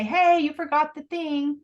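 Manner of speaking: mild call across the room. Shown as a demonstration. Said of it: "don't call to him and say".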